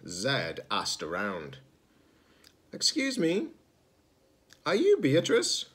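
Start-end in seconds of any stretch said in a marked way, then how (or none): 2.7-5.7 much higher, polite little robot; young robot voice. Acted. Zed asking a stranger for Beatrice, much higher and polite. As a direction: ask it up high and politely.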